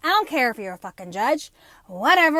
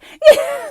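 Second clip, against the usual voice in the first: high burst of laughter; 12 dB louder than usual. Real, not acted. Laughing at own story.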